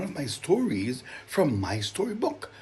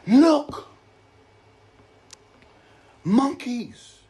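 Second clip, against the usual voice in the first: awed exclamation; 14 semitones higher, 8 dB louder, much slower than usual. Acted. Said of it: "Jada whispered in awe".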